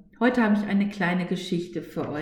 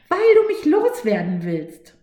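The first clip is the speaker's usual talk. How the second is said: high, louder, panicky almost-shriek; near shriek; 10 semitones higher, 7 dB louder than usual. Acted. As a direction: nearly screech it out in alarm. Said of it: "Jetzt kreischt sie fast".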